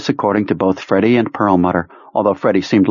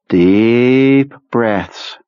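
slow, soothing commander; calm him down gently; soothing Kurtz voice; 3 semitones higher than usual. Acted. Kurtz soothing sick man.

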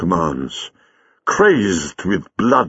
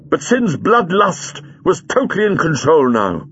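plain reading voice

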